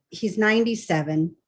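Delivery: ordinary talk, nothing marked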